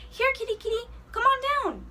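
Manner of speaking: high coaxing call up a tree; coaxing a stuck kitten down from the tree. Acted.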